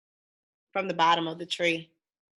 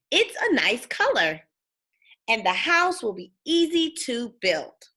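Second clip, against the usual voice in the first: put-on lively read-aloud for kids, bouncy tune; storybook reading; 4 semitones higher, 6 dB louder than usual. A narrator's reading. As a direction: read it like bedtime story time.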